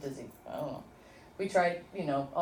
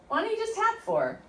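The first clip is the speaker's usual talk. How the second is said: little inner voice; 12 semitones higher than usual. Retold or imitated. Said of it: "And the little voice said".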